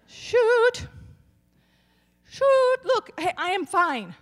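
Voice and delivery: high, chirpy mock outburst; impersonating mother. Mother cutting off her hand, chirping mild curses. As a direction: play a prim mom who won't swear. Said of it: "That's my mother".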